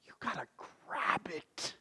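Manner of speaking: hushed whispered urging. Real, not acted. Preacher whispering to the congregation to grab life. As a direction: whisper it close to the listener.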